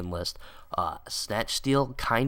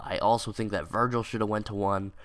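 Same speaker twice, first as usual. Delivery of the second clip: usual speaking voice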